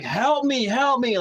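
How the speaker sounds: high, urgent retold yell; yelling for help. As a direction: imitate calling out repeatedly for help. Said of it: "literally yelling at them".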